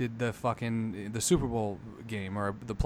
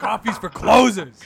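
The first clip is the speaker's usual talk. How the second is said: mock boss yell; 8 semitones higher, 9 dB louder than usual. Quoting the famous speech.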